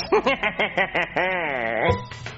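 long villainous chuckle; scheming laughter; evil laugh. Acted. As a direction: cackle over the revenge plan. Pig villain plotting revenge.

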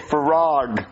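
slow "frog" as "fuh-rog"; correct pronunciation demo. Shown as a demonstration. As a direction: model "frog" with a clear R. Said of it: "not how you say it".

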